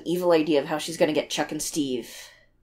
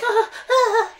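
high laugh. Real, not acted. Laughing at scared brother.

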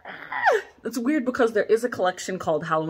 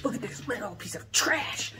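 softer whispered put-down; catty whisper; 6 dB quieter than usual. Retold or imitated. Showing her sister the ugly decoration, catty whisper. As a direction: hiss it like gossip. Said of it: "I was like".

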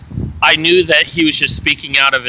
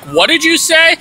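much higher, incredulous; startled challenge; 12 semitones higher than usual. Retold or imitated. Challenging the roommate's threat, incredulous. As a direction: demand he repeat it.

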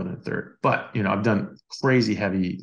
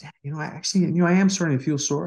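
higher, reluctant confession; sheepish admission; 8 semitones higher, faster than usual. Retold or imitated. Surgeon friend admits to the speaker he feels sore, reluctant higher voice. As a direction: admit it grudgingly, voice up high.

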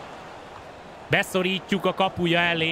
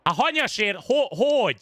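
frustrated gamer; 6 semitones higher than usual. Real, not acted. Frustrated at the controls.

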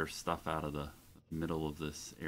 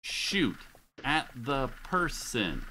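higher, louder, slowing pleading whine; real frustrated pleading; exasperated plea; 7 semitones higher, 3 dB louder, slower than usual. Real, not acted. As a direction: beg the teammate in disbelief. Pleading with a useless teammate.

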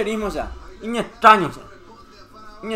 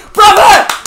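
loud, high yell; shouted appeal; 7 semitones higher, 8 dB louder than usual. Real, not acted. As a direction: shout at the referee. Appeals to referee. (grito de reclamo al árbitro)

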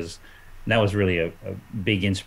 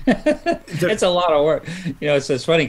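amused, laughing; 9 semitones higher, 5 dB louder than usual. Real, not acted. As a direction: say it through a real laugh. Laughs at his admission.

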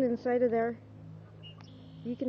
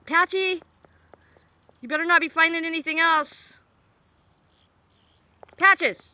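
loud, higher call across the yard to the dog; 8 semitones higher, 7 dB louder than usual. Real, not acted. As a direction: call the dog off firmly, raised voice. Calling the dog away.

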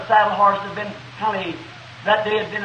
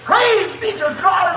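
retold worship shout, nearly real, with a laugh breaking through; shouting praise; 10 semitones higher, slower than usual. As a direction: cry it out to the mountains at full voice. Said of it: "I went shouting top of my voice".

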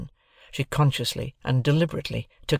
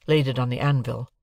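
plain reading voice

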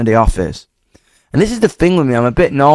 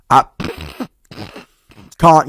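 ordinary talk, nothing marked

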